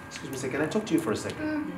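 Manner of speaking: softly asks the boss for a word, fast and polite; a soft, careful employee at the boss's door. Acted. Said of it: "tomorrow, they will knock".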